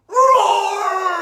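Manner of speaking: a big, high, loud roar; teacher roaring like a tiger for the class. Shown as a demonstration. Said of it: "I want you to roar as loud as you can".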